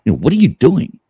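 a hint of a startled cowboy.

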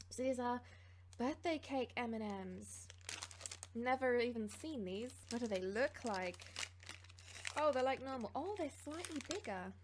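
ordinary talk, nothing marked

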